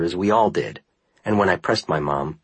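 ordinary delivery

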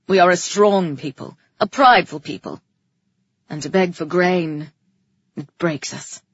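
public speech. A narrator's reading. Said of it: "Calyx said".

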